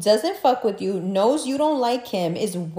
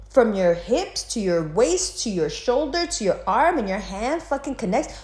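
usual speaking voice

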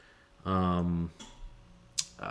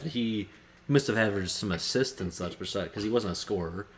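ordinary delivery

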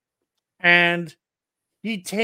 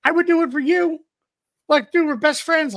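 high, pleading retold voice begging his friend; mock pleading; 9 semitones higher, faster than usual. To best friend, pleading in a high voice. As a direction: beg your best friend, voice way up. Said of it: "I'm like".

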